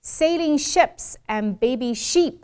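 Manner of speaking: slow, short i in "ships", long ee in "sheep"; long-short vowel contrast. Shown as a demonstration. Teacher contrasting long and short I, slow and distinct. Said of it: "长义和短义".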